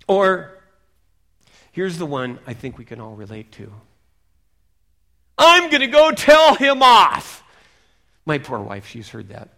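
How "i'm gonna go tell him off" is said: loud, high, slow indignant shout; full-voiced mock fury; angry outburst.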